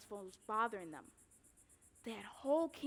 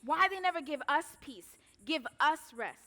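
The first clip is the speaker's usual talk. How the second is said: angry outburst; 5 semitones higher, 7 dB louder than usual. Acted.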